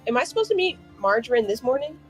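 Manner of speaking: normal talking voice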